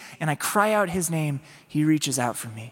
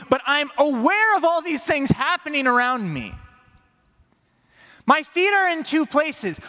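higher, pleading inner voice; anxious divided plea; Peter's torn prayer; 11 semitones higher, 4 dB louder than usual. Acted. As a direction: pray while eyes drift to waves.

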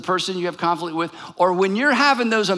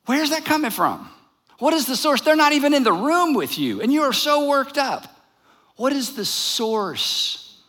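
higher-pitched, incredulous questioning; challenging questioning; 5 semitones higher than usual. Acted. James questioning an argument held alone in the head, incredulous. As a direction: press him with high, pointed questions.

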